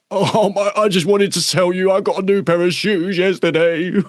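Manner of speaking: mock a nervous member with trivial news; timid member. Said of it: "They're like".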